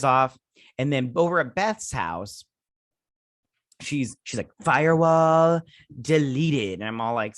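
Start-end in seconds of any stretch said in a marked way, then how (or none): 4.6-6.8 slow, loud, higher announcing voice; mock system alert, raised and stretched; imitated computer announcement. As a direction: announce it drawn out, word by word. Acting out Beth's computer announcement.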